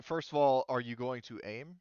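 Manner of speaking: ordinary talk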